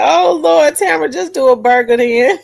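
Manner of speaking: giggling mock exasperation; laughing through quote. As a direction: read the comment through real laughter. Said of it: "They said".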